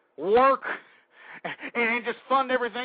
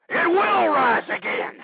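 louder, higher, hitting will; rallying shout; 7 semitones higher, 6 dB louder, slower than usual. Acted. Host shouting a rallying vow to listeners. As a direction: shout the promise, leaning on will.

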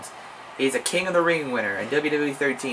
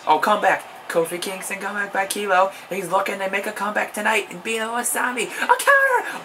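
excited; 8 semitones higher than usual. Real, not acted. Hyped play-by-play call.

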